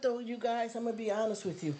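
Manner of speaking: ordinary talk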